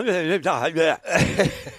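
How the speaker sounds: short laugh before the quote; chuckle, remembering it. Real, not acted. Laughs before quoting Dalglish.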